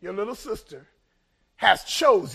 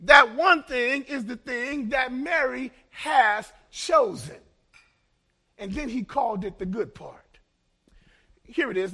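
normal talking voice

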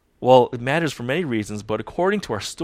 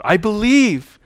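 higher, louder pleading cry; desperate father's cry; 8 semitones higher, 5 dB louder, slower than usual. Retold or imitated. Desperate father crying out to Jesus, pleading. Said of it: "desperate father who cried out".

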